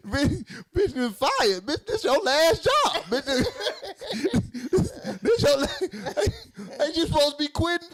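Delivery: can't get it out for laughing; real laughter mid-rant; laughing retort. Real, not acted. Laughing through his retort.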